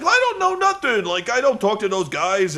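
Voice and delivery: very high, squeaky protest of innocence; hammed-up Taz insisting he knows nothing; caricature denial. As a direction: plead innocence in a put-on voice. Taz denies ties to ECW.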